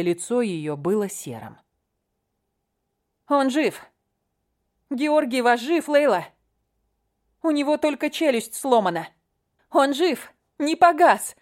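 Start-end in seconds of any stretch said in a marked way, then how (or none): 3.3-11.4 high desperate shout. Acted. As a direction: shout it out with relief and urgency. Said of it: "крикнула Катя".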